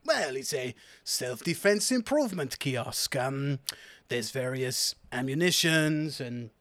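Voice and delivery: put-on French-accented sales patter. Seller explaining his wares to customers, French accent. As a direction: pitch the goods as a French stallholder. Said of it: "I will do this accent".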